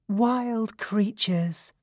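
high, slow and sweet fairy voice; sweet gentle voice. Acted. Mountain fairy gently greets the noisy satyrs, sweet and high. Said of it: "she said in a sweet and gentle voice".

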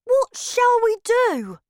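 high, loud, slow worried question; Bruno's voice. Acted. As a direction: ask for help, at a loss. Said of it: "he said".

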